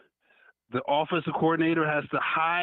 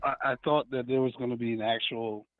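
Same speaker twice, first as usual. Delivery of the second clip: caller on a phone line; thin phone-line audio; over the phone; 4 semitones lower than usual. Real, not acted. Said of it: "who's calling in?".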